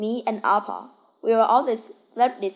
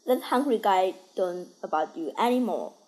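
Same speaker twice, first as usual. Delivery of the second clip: ordinary delivery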